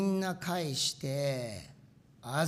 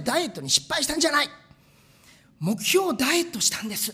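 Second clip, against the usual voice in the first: mock proud quote; 6 semitones higher, 8 dB louder, faster than usual. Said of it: "って言ってたんですけど".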